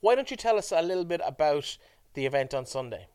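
normal talking voice

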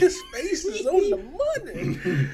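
high and loud through laughter; laughing. Real, not acted. Joking with a co-host about money, laughing. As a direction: crack up while saying it.

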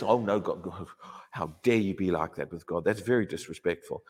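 low, soft, pious scolding; mock scolding enemy. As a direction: play the enemy sneering piously. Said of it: "the enemy sits there and says".

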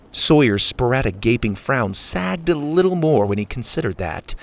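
plain reading voice

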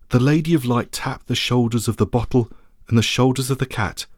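ordinary delivery

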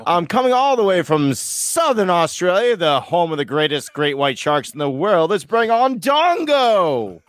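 announcer intro. Pretend. Hosting guest introduction.

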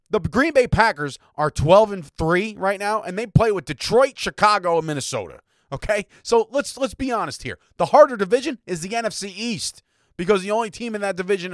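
getting fired up defending the Cowboys; worked up. Real, not acted. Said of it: "Whew, getting worked up here".

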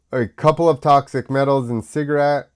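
ordinary delivery

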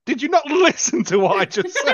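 mock-exasperated, high and laughing; a hammed-up snap at his girlfriend, half laughing; exasperated retort. Retelling the boyfriend scolding her, mock exasperated.

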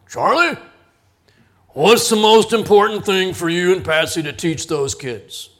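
booming question from a big mentor; lightly voiced deep big man asking loud. Big mentor quizzing the young father, booming voice. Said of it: "deep voice".

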